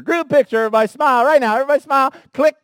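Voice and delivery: loud, high call to a crowd; a photographer calling everyone to smile. Pretend.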